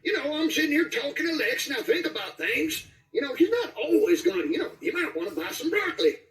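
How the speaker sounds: put-on high, meandering old-timer chatter; Dynamite voice. Shown as a demonstration. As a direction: ramble on like a cracked old prospector. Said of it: "'cause when I would talk like him".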